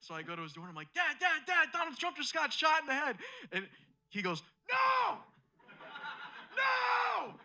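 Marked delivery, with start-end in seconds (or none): 0.9-3.2 high, loud mock-alarmed shouting; acted urgent yell. Son waking his dad with shocking news, acted urgent yell. 4.7-7.3 very high, loud acted wail of disbelief; mock horrified scream. Dad hearing his hero was shot, mock horrified scream. Dad reacts in shock to news.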